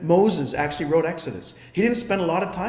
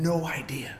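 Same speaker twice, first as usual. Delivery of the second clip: whisper; 3 semitones lower, 7 dB quieter than usual. Acted. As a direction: drop to a breathy secret whisper. Hushed storytelling moment.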